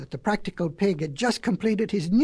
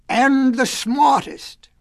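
slow loud demand; 4 semitones higher, 4 dB louder, much slower than usual. Acted. Wolf demands praise, shouting.

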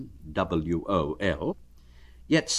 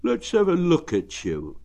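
cheerful bear; 9 semitones higher than usual. Acted. Pooh asking Eeyore how he is, friendly. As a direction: ask it kindly like a plump bear.

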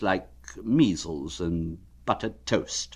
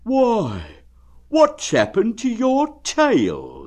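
much higher, louder, slow astonished bear; surprised; 13 semitones higher, 6 dB louder, slower than usual. Acted. Pooh noticing Eeyore's missing tail, surprised. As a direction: gasp it out in astonishment. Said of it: "he said in surprise".

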